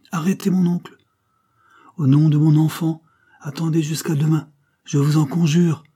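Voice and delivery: put-on young woman on her knees begging her uncle; desperate pleading. A narrator's reading. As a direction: beg him to stop, with everything you have. Fernande begs uncle on knees.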